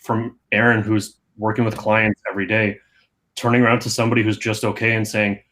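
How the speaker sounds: normal talking voice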